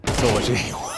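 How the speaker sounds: usual speaking voice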